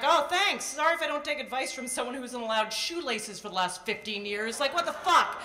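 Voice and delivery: loud, high indignant sarcasm; sarcastic retort. Retold or imitated. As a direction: snap back with mock outrage.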